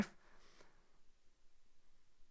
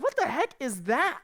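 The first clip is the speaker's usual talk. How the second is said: baffled; 6 semitones higher, slower than usual. Retold or imitated. Bewildered reader's reaction.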